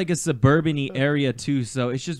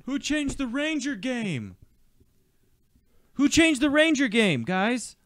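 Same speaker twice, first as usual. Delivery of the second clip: high, slow mock dad call; yelling across the room; 9 semitones higher than usual.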